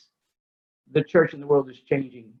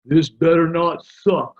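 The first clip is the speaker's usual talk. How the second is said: slow, put-on student voice; a slow, louder student warning; students' grumble; 5 dB louder, slower than usual. Student warning the retreat leader, grumbling. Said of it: "the students had expectations".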